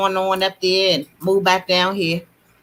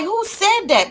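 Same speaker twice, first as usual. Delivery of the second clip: a much higher, startled "who said that"; startled question; 9 semitones higher, slower than usual. Retold or imitated. Retelling hearing God in the car, a startled, high question. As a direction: jump as if a voice came from nowhere. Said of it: "And I'm like".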